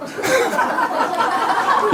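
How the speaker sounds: high, breathy burst of laughter; burst of real laughter. Real, not acted. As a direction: crack up at the joke. Student's joke startles preacher. (터져 나오는 진짜 웃음)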